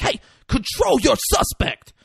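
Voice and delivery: high, sharp order; mock stern cop. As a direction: bark it at the rookie. Denzel scolding partner.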